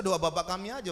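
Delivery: ordinary talk, nothing marked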